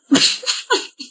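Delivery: high breathy giggle; snickering giggle; stifled laugh. Acted. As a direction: laugh at her, trying to hide it. Mocking laughter.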